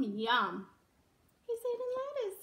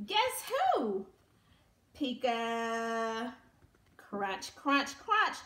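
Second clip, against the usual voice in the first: usual speaking voice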